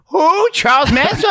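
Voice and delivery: put-on high and loud, puzzled old lady; grandma voice. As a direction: squawk it like a half-deaf grandma.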